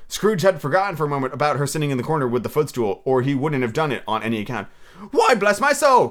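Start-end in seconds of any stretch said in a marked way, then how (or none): 5.1-6.1 loud, high, hearty exclamation; astonished cry. Acted. Nephew startled by his uncle's visit, astonished cry. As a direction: exclaim in delighted shock. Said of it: "cried Fred".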